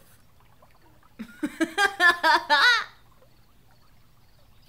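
loud, high burst of laughter; real high-pitched cackling laugh. Real, not acted. Amused by the t-shirt story, loud laughing.

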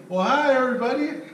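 a hostess welcoming a crowd; nice lady greeting. Retold or imitated. Wife greeting the trainees, sing-song friendly. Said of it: "She ends up being a nice lady".

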